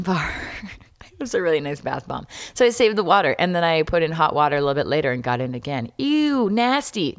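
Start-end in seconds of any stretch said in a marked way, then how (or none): 1.1-2.3 quick breathy aside; whispering. Real, not acted. Whispered aside about bath bomb. 6.0-7.1 high-pitched mock disgust. Imagined listener reacts with disgust.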